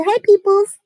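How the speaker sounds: high playful call. Real, not acted. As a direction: call her over cheerfully. Calling daughter to greet viewers.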